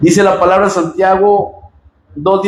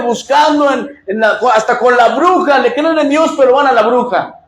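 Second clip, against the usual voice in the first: higher, impassioned pulpit delivery with shouted peaks; fiery preaching; 5 semitones higher than usual. Acted. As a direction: preach it hard to the congregation. Preaching rising to full force.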